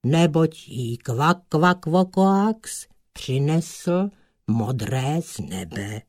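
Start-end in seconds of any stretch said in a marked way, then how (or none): none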